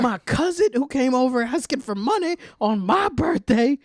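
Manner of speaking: high, strained cartoon old man; mock sixty-two-year-old man. Old man ranting about his cousin, high mock voice. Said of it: "a sixty-two-year-old man was just like".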